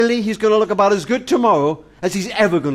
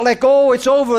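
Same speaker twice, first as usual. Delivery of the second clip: raised, urgent cry of letting go; 7 semitones higher, 5 dB louder than usual. Retold or imitated. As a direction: call it out like an inner voice screaming. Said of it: "everything will scream out".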